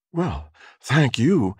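high, slow female thanks; high-pitched, slow young woman; young woman's voice. A narrator's reading. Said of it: "the young woman said".

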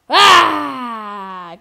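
loud drawn-out groan-shout; frustrated shout. Real, not acted. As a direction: yell in dismay at the play. Frustrated yell at the game.

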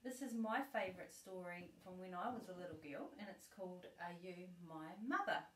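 ordinary delivery

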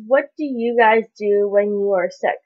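ordinary talk, nothing marked

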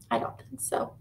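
soft unvoiced whisper; whispered aside. Real, not acted. Teacher answering the children's question in a whisper. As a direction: let it slip in a hush. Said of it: "aside to children".

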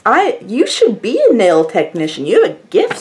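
usual speaking voice

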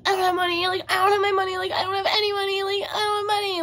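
high-pitched tearful mock whine; hammed-up crying, wailing each phrase; imitated sobbing. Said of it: "I just started sobbing".

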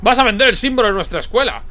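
high, loud indignant cry; outraged shout. Acted. Old headmaster shouting at the director in outrage. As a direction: explode at the betrayal.

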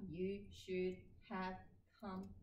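You should have come slowly, each word fully stressed; over-careful robot reading; You should have come with no reduction at all; unreduced, word by word. Shown as a demonstration. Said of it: "Et pas".

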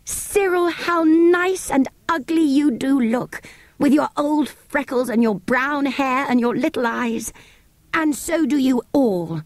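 high, slow, delighted near-tears; tearful joy. A narrator's reading. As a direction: gush with relief over them. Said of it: "almost in tears of joy".

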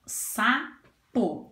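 "Sapo" broken into "Sa... po"; syllable division. Shown as a demonstration. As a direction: pause firmly between the two syllables of "Sapo". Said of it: "Vamos fazer a divisão dela em sílabas".

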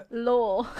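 loud, laughing mock-British "Lore"; British-accent "Lore", belted out through giggles; mimicking George Not Found. Teasing how a British streamer says lore, mimicking accent. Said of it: "make George Not Found say the word lore".

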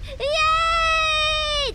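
loud high victory yell; excited cheer. Real, not acted. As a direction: shout for joy at the win. Boss beaten, cheering.

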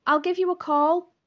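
loud, high-pitched call from afar; distant shout back. A narrator's reading. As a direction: shout it across a crowded room. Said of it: "his distant voice shout back".

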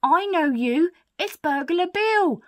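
high, loud, excited recognition; lady thief thrilled to recognise someone; Burglar Betty. Acted. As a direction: exclaim it as the penny drops, drawing out the name. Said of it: "says Burglar Betty".

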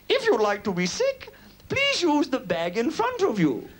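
high, drawn-out tannoy announcement; woman on tannoy. Acted. Woman on the plane's tannoy addressing passengers, announcer voice. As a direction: imitate a woman's airline announcement over the tannoy. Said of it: "a woman's voice came on the tannoy".

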